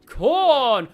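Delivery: ordinary talk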